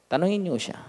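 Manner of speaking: normal talking voice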